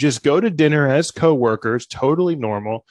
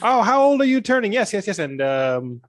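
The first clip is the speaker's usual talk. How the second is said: higher, slow, chirpy host patter; cheesy improv host; 7 semitones higher, slower than usual. Pretend. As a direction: play the eager showman warming up a crowd. Villain hosting improv show.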